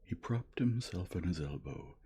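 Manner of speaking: plain reading voice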